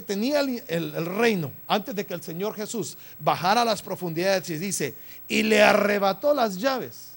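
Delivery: full-force preaching. Real, not acted. Preacher proclaiming Hebrews to congregation, full-force preaching. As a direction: hammer the scripture home with mounting volume.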